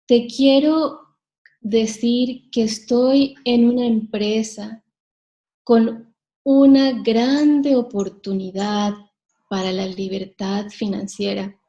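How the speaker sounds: slow, dragging, monotone sales talk; boring slow pitch. Shown as a demonstration. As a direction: bore them with a drawn-out pitch. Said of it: "¿A que os aburrís?".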